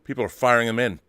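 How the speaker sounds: usual speaking voice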